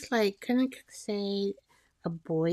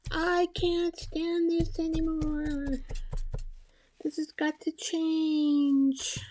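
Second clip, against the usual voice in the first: high, drawn-out mock scream of frustration; stretched-out, exasperated cry; acted screaming; 9 semitones higher, 4 dB louder than usual. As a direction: act out reaching breaking point, voice raised high. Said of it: "then you start screaming".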